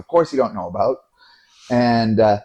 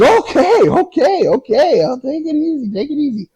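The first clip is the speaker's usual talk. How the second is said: very high, yielding okays; placating driver; 4 dB louder than usual. Retold or imitated. Cab driver gives in to the threatening passenger, high and placating. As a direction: squeal it as an imitated cabbie backing down.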